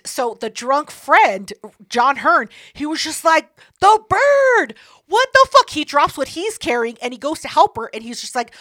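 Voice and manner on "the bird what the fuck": high, loud outburst of disbelief; exasperated mock shout. Friend reacting to dropped cupcakes, in a high exasperated mock shout. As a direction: imitate a drunk friend hollering in dismay. Drunk friend exclaims over spill.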